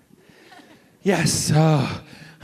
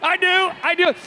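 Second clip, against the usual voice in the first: much higher, tight voice through pain; pained agreement. Retold or imitated. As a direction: grit out a yes while still hurting. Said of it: "He went".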